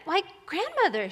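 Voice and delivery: put-on high, lilting little girl voice; sing-song innocent surprise; puzzled little girl. A narrator's reading. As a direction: wonder aloud as a storybook child. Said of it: "she said".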